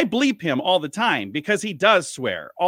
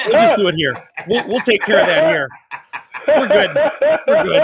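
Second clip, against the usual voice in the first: lightly voiced station owner, louder, laughing it off; breezy reassurance, louder, chuckling at the end; imitating the station owner; 5 semitones higher, 8 dB louder than usual. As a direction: owner waving off the trouble, louder and amused. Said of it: "And he's like".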